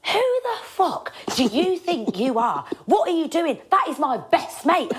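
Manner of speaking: high, heated mock rant at a stranger; mock angry outburst. Jack defending his best mate, hammed-up furious outburst. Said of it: "He went".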